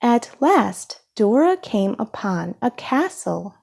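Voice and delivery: ordinary delivery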